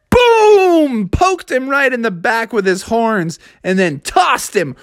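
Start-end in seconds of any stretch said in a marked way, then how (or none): 0.1-1.1 high, punchy sound effect; impact boom. A narrator's reading. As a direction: boom out the sudden hit. Bull's hit imitated in story.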